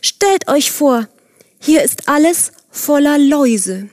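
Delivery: high, slowed-down alarmed report; alarmed announcement. A narrator's reading. Worker tells the others of lice on the vines, alarmed. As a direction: break bad news with storybook alarm, pitched high.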